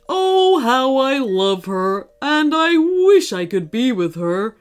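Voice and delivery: dreamy, lovesick sigh of a voice. Acted. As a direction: swoon over her from afar. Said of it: "he said dreamily".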